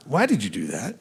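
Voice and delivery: low, soft, displeased question; quietly pointed, pitched low. Shown as a demonstration. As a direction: keep it down, with a little edge. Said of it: "my tone of voice is this loud".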